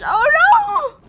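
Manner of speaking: high, loud wail; drawn-out panicked "oh"; alarmed cry. Real, not acted. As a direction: howl in dismay. Cries out at game danger.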